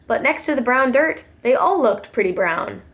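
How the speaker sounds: plain reading voice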